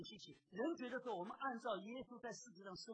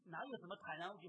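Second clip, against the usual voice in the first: ordinary talk, nothing marked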